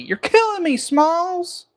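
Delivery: high-pitched, theatrical mock exasperation; hammed-up movie quote.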